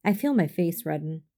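plain reading voice

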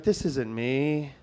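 low, slow murmur to himself; subdued inner thought; sober self-talk. Retold or imitated. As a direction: say it slowly and softly, sinking in pitch. Sober thought facing the mirror.

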